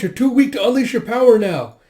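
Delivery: high, very loud urgent yell; urgent warning. Acted. Companion warns Sinha off using the power, urgent shout. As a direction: shout them down in alarm.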